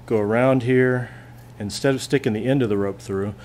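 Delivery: ordinary talk, nothing marked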